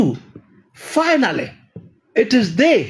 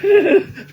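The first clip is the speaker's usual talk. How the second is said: laugh; 9 semitones higher than usual. Real, not acted. Laughs at foreigners' view.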